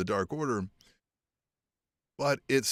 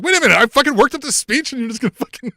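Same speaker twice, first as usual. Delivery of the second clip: fast, loud, much higher mock tantrum; mad rant; 12 semitones higher, 9 dB louder, faster than usual. QT Marshall furious his speech got skipped, fast mock shout. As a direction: spit it out in a hammed-up fury. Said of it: "now he's mad that he's like".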